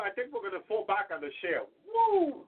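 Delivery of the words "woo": excited whoop. Real, not acted. Joyful outburst in sermon.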